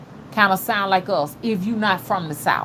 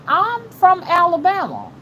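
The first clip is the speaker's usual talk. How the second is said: higher-pitched mock protest; retold insistence; 8 semitones higher than usual. Telling doubters where she is from, insistent.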